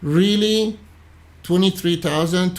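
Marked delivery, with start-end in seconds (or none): none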